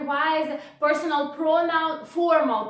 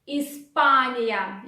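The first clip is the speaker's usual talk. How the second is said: normal talking voice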